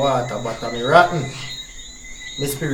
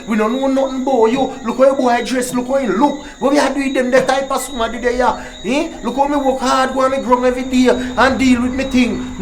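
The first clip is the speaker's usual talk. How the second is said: stern lecture; 7 semitones higher than usual. Real, not acted. Father lecturing daughter about boyfriend.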